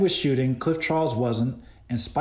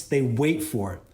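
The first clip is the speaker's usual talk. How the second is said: ordinary talk, nothing marked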